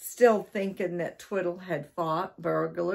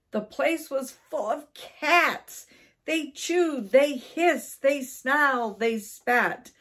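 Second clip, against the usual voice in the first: higher, punched list of cat noises; dramatic narration; 8 semitones higher, 4 dB louder than usual. A narrator's reading. Narrator reveals a larder full of cats, high dramatic punch. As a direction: build excitement, hit each verb. Horrified discovery of many cats.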